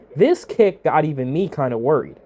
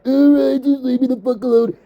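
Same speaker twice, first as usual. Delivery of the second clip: higher, louder mock Triple H giving in; Triple H caving; 7 semitones higher, 4 dB louder than usual. Host mocks Triple H caving to the internet, higher whiny mock voice. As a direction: whine it like a boss worn down by fans. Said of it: "he's like".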